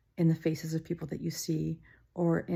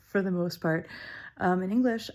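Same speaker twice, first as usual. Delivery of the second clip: ordinary talk, nothing marked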